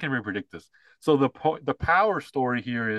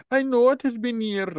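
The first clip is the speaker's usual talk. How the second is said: put-on high young woman voice; 12 semitones higher than usual. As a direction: read it as the grown daughter, sweetly. Acting daughter's line from the ad.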